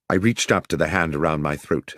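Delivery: plain reading voice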